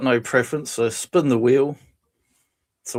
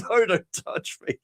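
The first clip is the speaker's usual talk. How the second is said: higher, slow mock-horrified refusal; recoiling in terror; 7 semitones higher, slower than usual. Date recoiling from a goodbye kiss, mock terror. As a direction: act out shrinking away in horror. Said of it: "she's, like, recoils in terror".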